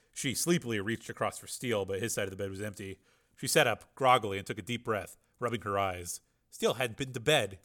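usual speaking voice

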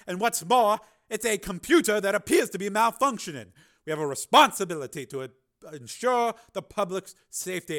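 loud, high commanding reprimand. Acted. Senator reprimanding the engineer, stern and loud. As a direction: come down hard on him.

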